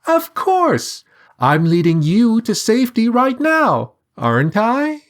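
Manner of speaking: cheerful high sing-song; sing-song bird. A narrator's reading. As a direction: sing it down from the sky. Bird character voice.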